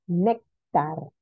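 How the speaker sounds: Néctar in two parts, weight on NÉC; stress on penultimate syllable. Shown as a demonstration. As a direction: stress NÉC in Néctar, pause before tar. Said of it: "Segunda sílaba, penúltima".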